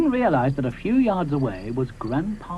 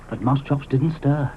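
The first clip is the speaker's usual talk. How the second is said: ordinary delivery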